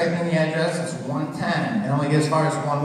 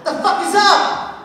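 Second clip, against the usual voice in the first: sudden high yelled insult; profane shout; 8 dB louder, slower than usual. Retold or imitated. Business manager yelling at a caller. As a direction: blurt it out loud into the phone.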